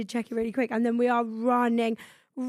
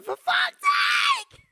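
mock outburst, much higher; a hammed-up cry of frustration, pitched way up; exasperated outcry; 4 dB louder than usual. Friends retell their reaction to the delay, high exasperated cry.

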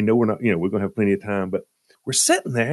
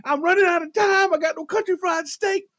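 high, shouted rant; acting out a diner losing it; freaking out. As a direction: rail at the waiter in high-pitched outrage. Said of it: "like, freaking out".

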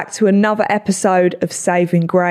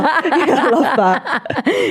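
slow words through a laugh; 7 semitones higher, much slower than usual. Real, not acted. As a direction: laugh while saying it. Amused reaction to guest's answer.

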